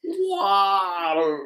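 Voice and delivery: high, whimpering drawn-out wail; hammed-up tearful lament; mock anguished why. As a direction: whimper it out in fake despair. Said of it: "more of the why".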